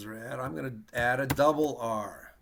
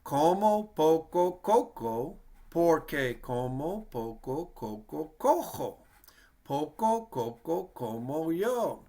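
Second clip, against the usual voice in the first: a bit faster; 3 semitones higher, slower than usual. Shown as a demonstration. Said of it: "Maybe speed it up a little bit".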